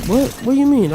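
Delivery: high-pitched mock surprise; feigned innocence. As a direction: act baffled by the accusation, voice jumping up.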